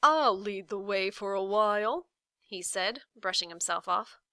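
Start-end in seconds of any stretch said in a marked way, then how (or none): 0.0-2.1 pompous Humbug. A narrator's reading. Humbug takes the lead.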